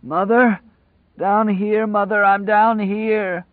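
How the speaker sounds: high, hoarse, drawn-out call from below; a madman joyfully calling for his mother from a cell; high rapturous crazed voice; rapturous hoarse answer. Acted. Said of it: "the rapturous hoarse answer".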